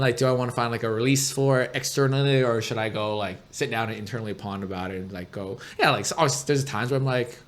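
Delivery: usual speaking voice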